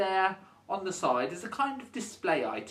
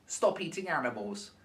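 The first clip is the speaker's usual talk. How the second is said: normal talking voice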